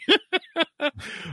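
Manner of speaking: sudden high laugh, amused at himself; high burst of laughter. Real, not acted. Laughing at his oversleeping.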